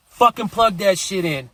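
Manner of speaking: mock-furious, impatient demand; retold angry order. Ordering girlfriend to plug phone.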